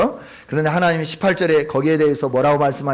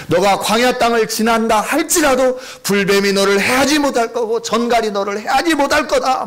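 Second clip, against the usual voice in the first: full-force preaching; 7 semitones higher, 3 dB louder than usual. Acted. Pastor proclaiming God's promise to the congregation, fervent preaching.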